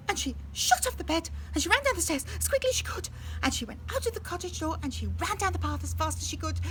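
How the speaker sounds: hurried chase narration; fast narration. A narrator's reading. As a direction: rush it like running away. Goldilocks flees.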